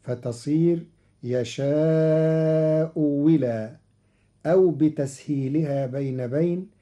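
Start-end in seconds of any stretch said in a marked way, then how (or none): 1.2-3.8 slow, يشاء ولا with the second hamza said as a waw with kasra; hamza turned to waw. Shown as a demonstration. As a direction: say ولا as wi, drawn out. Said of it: "بتغيير الهمزة الثانية واوا مكسورة".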